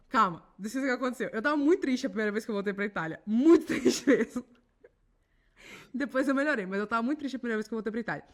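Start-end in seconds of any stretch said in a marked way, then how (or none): 3.3-4.4 slow, loud, laughing through it; real laugh mid-phrase. Real, not acted. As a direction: laugh while insisting. Laughs while stressing sadness.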